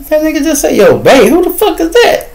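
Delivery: high, outraged disbelief; incredulous retelling. Retold or imitated. Retelling his shock at the man's words, high squeal. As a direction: squeal it like you can't believe it. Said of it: "I hear it, I'm like".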